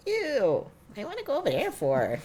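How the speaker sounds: put-on high, scornful little-girl ew; mock disgust. Child sister scoffing at brother's school choice, high mocking voice. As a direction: sneer at the idea, kid style. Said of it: "I'm like".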